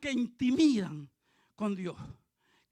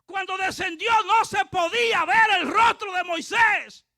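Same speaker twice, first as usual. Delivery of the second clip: loud, raised-pitch pulpit delivery; full-force preaching; 6 semitones higher, 7 dB louder than usual. Real, not acted. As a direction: preach it out to the whole congregation.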